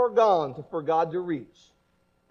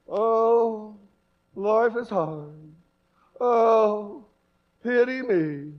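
a light mopey Eeyore moan; much slower than usual. Retold or imitated. Preacher mocks gloomy Christians as a lightly mopey Eeyore. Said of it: "walking around like E-, old Eeyore".

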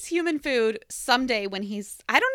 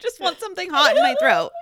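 joking louder; 4 semitones higher, 10 dB louder than usual. Real, not acted. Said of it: "jokey rephrasing".

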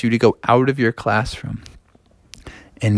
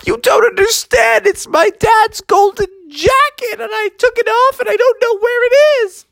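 crying shout. Acted. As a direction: wail it out in desperation. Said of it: "he was like".

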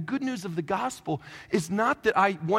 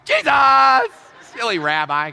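loud call, then a cheeky quip; mock calling out; 5 semitones higher, 10 dB louder, slower than usual. Pastor mock-searching for Jesus, shouting. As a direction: shout for him like a lost pet.

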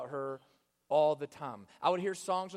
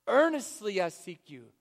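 Earnestly I seek you, louder and pressing; fervent prayer; 3 semitones higher, 7 dB louder than usual. Acted. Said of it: "When David says".

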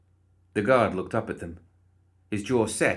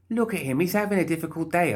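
cheery Molly voice; 9 semitones higher than usual. Acted. Molly chiding Archie.

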